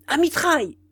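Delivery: high-pitched called command; cried order. A narrator's reading. Marquis turning to the peasant gunners, crying an order. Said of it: "il cria".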